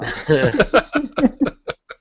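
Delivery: loud high burst of real laughter; big laugh. Real, not acted. Laughing at the banter.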